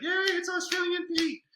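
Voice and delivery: put-on very high, loud squealing kid's cheer; kids cheering. Teacher imitating his class cheering for Australian Pete, squeaky and loud. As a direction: squeal like excited kids greeting a visitor. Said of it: "The kid's like".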